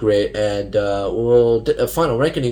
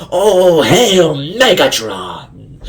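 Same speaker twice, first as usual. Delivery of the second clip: high, loud, drawn-out villain cry; raspy loud hail to the boss; Starscream hailing Megatron; 6 semitones higher, 7 dB louder, much slower than usual. Retold or imitated. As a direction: ham up a scheming jet leader's salute. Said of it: "I really liked how he was like".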